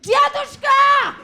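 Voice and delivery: loud, high, slow shout in Russian; frantic shouted Russian to grandpa. Retold or imitated. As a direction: yell it across the room in Russian.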